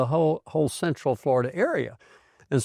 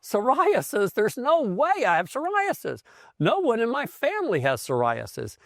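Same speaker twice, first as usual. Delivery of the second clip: shocked disbelief; 10 semitones higher than usual. Retold or imitated. Retold shock at the diagnosis.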